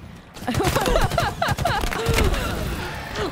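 high, pulsing mock baby wail; imitated child crying. Streamer showing a sign of pregnancy, fake child crying. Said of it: "like a child crying in the background".

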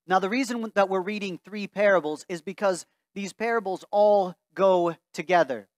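ordinary delivery

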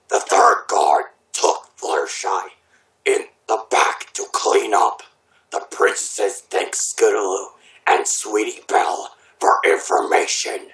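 very low, measured character narration; low reading voice. Acted. Reading fan fiction to friends, put-on low voice. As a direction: drop your pitch and read it deliberately.

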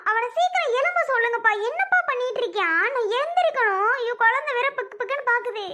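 high, panicked plea to her father; frightened. Acted. Daughter begs her father to make him get up, frightened. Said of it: "என் பொண்ணு ரொம்ப பயப்படுறா".

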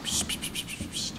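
hushed, voiceless psst sounds; whispered shushing. Real, not acted. Player sneaking past the monster, whispered shushing. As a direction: hush it as softly as possible.

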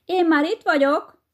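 high, loud triumphant call; loud shout. Acted. Hedgehog's wife pops up and yells at the hare, loud. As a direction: shout it across the field. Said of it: "harsányan oda kiabálja neki".

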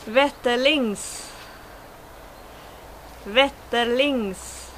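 Wetterlings pronounced the Swedish way. Shown as a demonstration. Teacher showing bushcrafters the brand Wetterlings, Swedish pronunciation. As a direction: model Wetterlings in Swedish, word on its own. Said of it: "words that I should, uh, pronounce".

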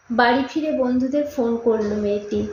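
plain reading voice

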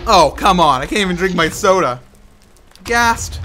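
loud exasperated groan of annoyance; real frustration. Real, not acted. Frustrated gamer while drinking soda.

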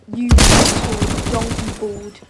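loud, drawn-out mock taunt; mock-aggressive, stretched-out yell; trash-talk shout. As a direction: yell the insult slowly at an opponent.

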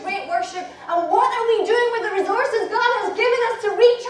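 loud, high fervent preaching; preaching at full force. Acted. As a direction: thunder it out to the congregation. Revival sermon to women.

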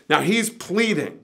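usual speaking voice

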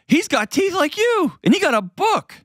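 very high, playful sing-out; excited parent. Pretend. Parent showing son a picture-book character, excited. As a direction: point it out to a little kid, delighted.

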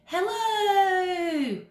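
high, far-off echo. Shown as a demonstration. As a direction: sound like an echo bouncing back. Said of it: "sound bounce off the cave walls".

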